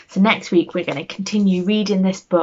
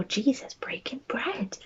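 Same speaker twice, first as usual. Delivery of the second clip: whisper; 4 semitones higher, 8 dB quieter than usual. A narrator's reading. Hushed moment in story.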